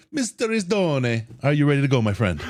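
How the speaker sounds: lightly hammed mock Italian on Risdone. Host teasing his co-host in light mock Italian. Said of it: "I can't even do an Italian accent".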